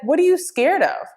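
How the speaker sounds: high, puzzled imitated child; incredulous. Child asks the scared swimmer why, incredulous. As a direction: mimic a child baffled by the fear.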